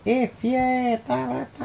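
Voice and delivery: mock drunk yell. Mimicking drunk woman yelling.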